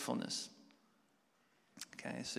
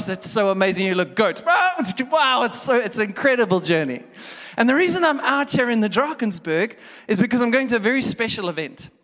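loud, high, animated storytelling; full-force preaching; 7 semitones higher, 15 dB louder than usual. Acted. Pastor telling a road-trip story, loud and high. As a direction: preach it big to the room.

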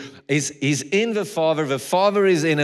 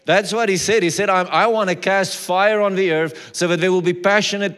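louder, higher preaching of scripture; preaching at full force; 6 semitones higher, 5 dB louder than usual. Acted. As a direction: proclaim the verse with rising force.

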